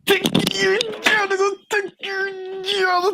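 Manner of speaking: high, slow fake wail; hammed-up sobbing lament; mock crying. Mock wail after job joke.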